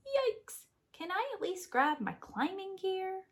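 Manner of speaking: high, jumpy yelp; mock scared Jack. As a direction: act a little spooked. Jack voiced in story.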